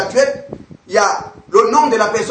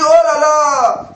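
a drawn-out, raised oh là là, half-mocking; retold cry of dismay; 5 semitones higher, 4 dB louder, much slower than usual. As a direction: slowly exclaim in mock alarm. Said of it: "Il a crié". (garagiste surpris par la distance, « oh là là » traîné et un peu plus fort)

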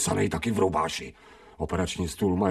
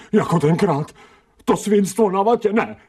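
high and louder, rising to a scream of no; panicked protest; 14 semitones higher, 6 dB louder than usual. Acted. Dog fighting the ether mask, panicked and loud.

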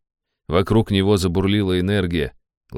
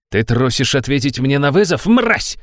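put-on drunk shriek, much higher and louder; 6 semitones higher, 5 dB louder than usual. A narrator's reading. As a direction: squeal with drunken rage. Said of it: "взвизгнул пьяный Каримов".

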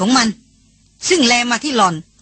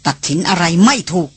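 usual speaking voice

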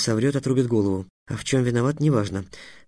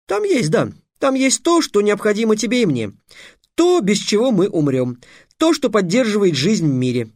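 put-on much higher, eager boyish voice; boy bluffing; 9 semitones higher, 4 dB louder than usual. A narrator's reading. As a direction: Trix stalls with grand words. Trix voice. (высокий взволнованный мальчишеский голос)

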